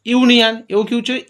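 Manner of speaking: usual speaking voice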